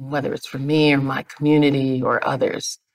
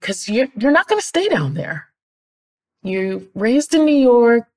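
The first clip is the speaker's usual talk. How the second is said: higher, emphatic retold boss; mock insistence, pitched up; boss's disbelief; 5 semitones higher, 4 dB louder than usual. Boss to departing employee who won't stay down there, disbelieving.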